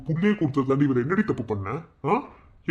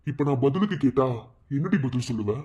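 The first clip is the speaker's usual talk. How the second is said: usual speaking voice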